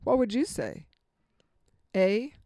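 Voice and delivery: ordinary talk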